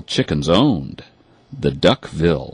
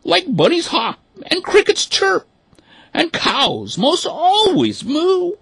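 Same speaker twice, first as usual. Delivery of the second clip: lecturing mother duck; 4 dB louder than usual. Acted. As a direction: insist like an indignant mother duck. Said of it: "she gasped".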